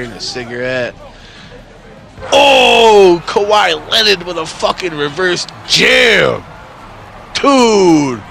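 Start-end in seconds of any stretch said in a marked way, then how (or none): 2.3-8.2 loud, high hype shout at the TV; sudden sports-fan outburst; excited shout. Real, not acted. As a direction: yell at a sick dunk. Reacting to basketball on TV.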